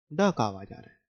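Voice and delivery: ड said as a hard retroflex d; d sound. Shown as a demonstration. Teacher answering her own question about the d sound. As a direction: land firmly on ड and let the line fall. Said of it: "किसका आवाज आ रहा है".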